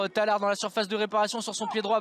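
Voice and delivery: ordinary talk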